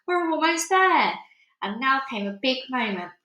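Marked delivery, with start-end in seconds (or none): 0.0-1.2 eager urging. A narrator's reading. Grasshopper urging.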